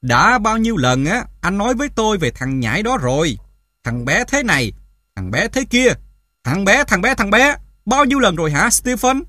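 loud, high, scornful tirade; mock him, building in exasperation; sarcastic. A narrator's reading. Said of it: "Mẹ bắt đầu bằng cái giọng điệu mỉa mai".

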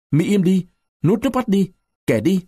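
restrained yell, pitched well up; narrated yell. A narrator's reading. Narrator as man grabbing a crier's hair, mild high yell. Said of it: "ta túm tóc nó hét".

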